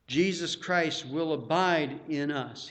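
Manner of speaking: ordinary delivery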